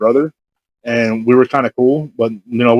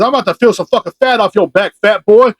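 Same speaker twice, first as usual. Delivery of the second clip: higher-pitched imitated threat; mock-menacing, pushing up in pitch; aggressive; 7 semitones higher than usual. Imitating the man confronting him outside the store, aggressive. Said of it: "came up to me all aggressive".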